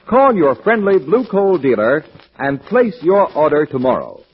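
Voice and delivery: ordinary delivery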